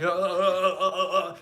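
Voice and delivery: loud, juddering "uh" sounds; imitated bumpy-road jitter. Driver jolted on LA freeways, mock juddering voice. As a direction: judder as if shaken by the car. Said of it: "you just hear the vibration of the car".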